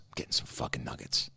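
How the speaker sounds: hushed, unvoiced whisper; a muttered vow under her breath. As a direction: breathe it out secretly. Mom muttering her plan.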